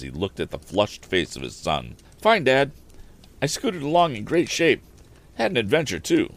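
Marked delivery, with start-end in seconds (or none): 2.2-6.3 put-on higher, brighter-pitched boyish voice, louder; an excited young man back from a ride; young Tom reporting his adventure, higher and louder; eager young Tom. Tom's voice in read story.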